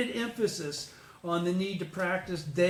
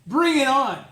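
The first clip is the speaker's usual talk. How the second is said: loud, higher "Bring it on"; defiant shout; 6 semitones higher, 9 dB louder than usual. Real, not acted. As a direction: throw it out like a challenge. Echoing listener with loud defiance.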